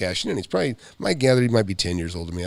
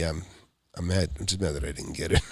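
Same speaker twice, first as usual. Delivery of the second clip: ordinary talk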